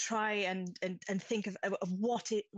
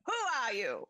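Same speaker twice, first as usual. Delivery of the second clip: crackly mask voice; 7 semitones higher than usual. Acted. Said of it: "the crackle of his voice comes out".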